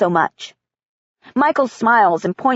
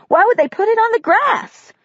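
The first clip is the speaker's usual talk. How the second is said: put-on puzzled girl; 12 semitones higher than usual. Caitlin puzzled question.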